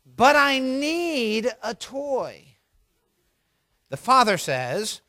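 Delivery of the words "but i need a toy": slow, high, drawn-out whine; whining little girl. Acted. Four-year-old daughter to her father in the toy aisle, whining. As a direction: whine it like a pleading child. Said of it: "The daughter whines".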